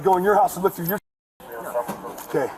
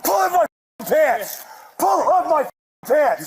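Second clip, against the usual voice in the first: angry shout; 6 semitones higher, slower than usual. Real, not acted. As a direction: bellow it at the officers. Arrest, yelling at police.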